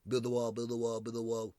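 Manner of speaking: monotone muttered chant in a put-on voice; droning mock Trump chant; roaming Trump. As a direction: chant it like a wandering ghostly ex-president. Trump roaming the halls like Sasquatch.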